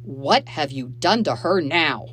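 loud, slow stern demand; angry accusation. A narrator's reading. Father turning on his stepchildren, angrily. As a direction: accuse them as a protective father.

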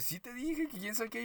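high, sing-song insistence; a high, mocking take on the co-worker's excuse; mock insisting. Co-worker insisting she told her.

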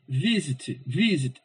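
"visit" with VI stressed, falling after. Shown as a demonstration. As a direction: lean on the first syllable of "visit". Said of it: "O vi é mais forte".

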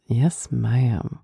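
lightly imitated Texas drawl; a hint of his Texas "ma'am". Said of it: "the way he says it".

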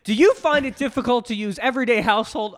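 loud, high infomercial pitch; hard sell ad. Pretend. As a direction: sell it like a late night ad. Infomercial parody.

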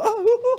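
high-pitched laughing ouch sounds; mock pain yelps. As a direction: yelp in pain while laughing. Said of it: "Y yo".

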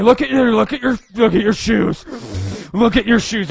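loud, high, trying to hold the tears in; mock tearful self-talk. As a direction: tell yourself to look down and not cry. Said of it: "turn away from the camera".

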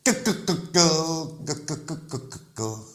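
slow, mocking clucked chant. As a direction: drag out the ko-ko-ko like a taunting chant. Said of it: "ο ύμνος του Βαζέλα".

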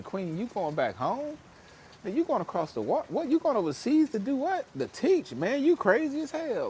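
high, rising disbelief, mocking; incredulous friend. Friend questioning Queen's move overseas, high-pitched and incredulous. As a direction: play the skeptical friend who thinks she's lost it. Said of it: "look at you like you was crazy".

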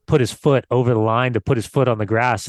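ordinary talk, nothing marked

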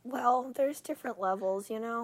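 tiny sweet voice of a hangover; sweet hungover voice. Real, not acted. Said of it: "Listen to how sweet she sounds when she's hungover".

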